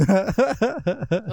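high burst of laughter. Real, not acted. Amused by the exchange, laughing high.

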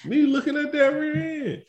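playful banter. Retold or imitated. Joking retold banter with son.

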